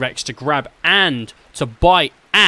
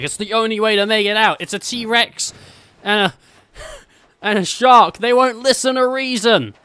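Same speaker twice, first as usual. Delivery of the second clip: urgent Rock; 8 semitones higher, 4 dB louder than usual. Retold or imitated. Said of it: "And The Rock's like".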